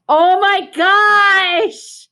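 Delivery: drawn-out, high, loud gasp of amazement; big surprise. Real, not acted. Teacher reading aloud to kids, shocked by the bear. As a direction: stretch it out in delight. Reader reacts to bear in canoe.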